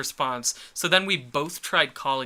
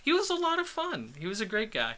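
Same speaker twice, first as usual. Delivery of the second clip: sarcastic; 9 semitones higher, 4 dB quieter than usual. Real, not acted. Sarcastic praise of drunk guy.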